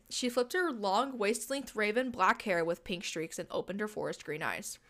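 ordinary talk

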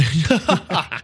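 high laugh. Real, not acted. Laughs at being called emo.

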